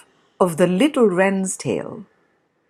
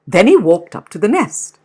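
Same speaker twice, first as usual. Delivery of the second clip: usual speaking voice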